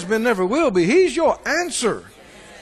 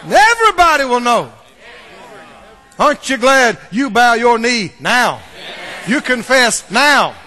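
loud, soaring proclamation; loud triumphant preaching; preaching at full force; 6 semitones higher, 8 dB louder than usual. Acted. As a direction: thunder it out to the congregation.

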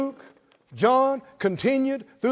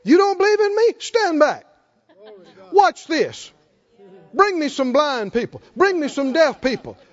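Jesus challenging; 7 semitones higher, 4 dB louder than usual. Retold or imitated. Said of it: "the perfect opportunity to say".

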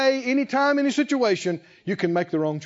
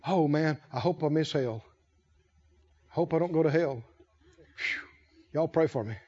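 mock fretful worry; 5 semitones lower, 6 dB quieter than usual. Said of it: "I never fret and go".

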